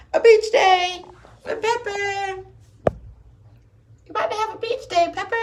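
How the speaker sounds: high pet-talk; high dog-talk voice. Real, not acted. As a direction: coo at your puppy in a high voice. Talking to her dog Pepper.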